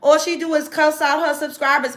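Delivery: high-pitched mock complaint; mocking other creators. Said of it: "content creators be like".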